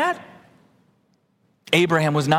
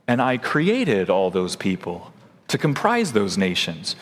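calm reply as God; 9 semitones lower than usual. Acted. Pastor voicing God's reply.

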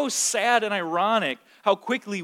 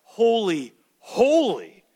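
"Holy, holy" called out louder and higher; crying out; 4 semitones higher, 6 dB louder, slower than usual. Retold or imitated. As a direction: cry the two holys out. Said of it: "nor do they cry out".

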